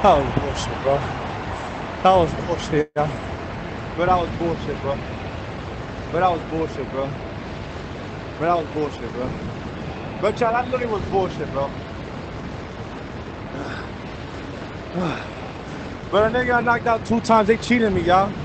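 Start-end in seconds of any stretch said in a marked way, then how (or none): 0.0-11.7 low, deflated grumbling; deflated complaint. Real, not acted. Player complaining after losing the match, low deflated grumble. 11.8-16.1 long, soft, voiceless exhale; long sigh. Real, not acted. Player deflated after losing the match, long soft sigh.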